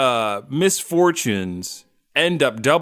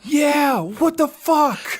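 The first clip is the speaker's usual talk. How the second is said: outraged disbelief; 12 semitones higher, much slower than usual. Acted. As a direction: squeal it out in outrage. Outraged parents mocking Archie.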